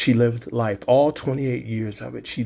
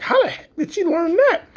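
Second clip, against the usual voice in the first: much higher, astonished voice; stunned disbelief; 3 dB louder than usual. Retold or imitated. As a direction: gasp it out in shock. Dad stunned by daughter's words.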